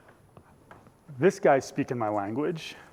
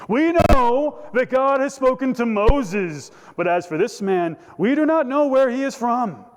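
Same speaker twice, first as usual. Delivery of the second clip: proclamation; 9 semitones higher, 5 dB louder than usual. Acted. Pharisees proclaiming what they know about Moses, lofty and loud. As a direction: pronounce it grandly, as if from God. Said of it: "one of these proclamations of what they know".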